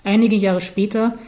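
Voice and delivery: plain reading voice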